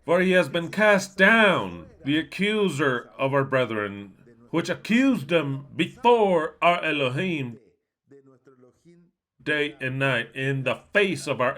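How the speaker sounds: slow, weighty scripture reading; preaching. Acted. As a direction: proclaim it slowly like a preacher.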